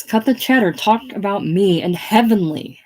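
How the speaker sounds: impatient demand. Acted. Chance interrupting princess on the phone, impatient demand. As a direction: cut in sharply, insisting.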